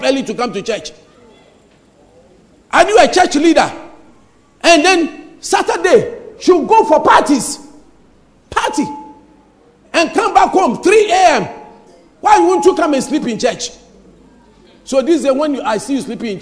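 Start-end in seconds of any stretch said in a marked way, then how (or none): 2.7-13.7 higher and louder, teasing the partygoers; loud mocking rebuke. Real, not acted. Preacher teasing churchgoers who party till three, loud mock rebuke. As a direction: call them out, half scolding, half joking.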